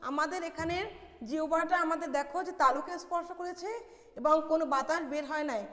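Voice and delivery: normal talking voice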